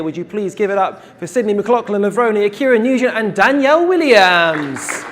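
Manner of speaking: rally the crowd, stretching the final name; announcer introduction. Real, not acted. Host welcomes three hurdlers onstage, building announcer shout.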